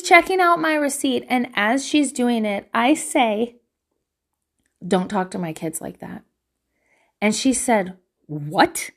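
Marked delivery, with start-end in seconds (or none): 4.8-6.2 low, controlled warning; no emotion. Retold or imitated. Mother confronting worker over her kids, low controlled warning. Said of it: "no emotion". 8.3-8.9 low, retold defensive retort; defensive what. Store worker confronted at receipt check, defensive. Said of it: "got real defensive".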